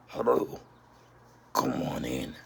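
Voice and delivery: low, raspy whispered lure from a monster; creepy figure impression. Glowing-eyed cellar figure luring Andy in, creepy low voice. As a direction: beckon eerily, dropping into a deep hushed growl. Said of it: "trying to do my best impression".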